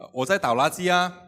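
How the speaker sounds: acting out an unabashed, self-assured reply; self-righteous confidence. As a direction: answer back as if entirely in the right. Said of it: "我还理直气壮地说".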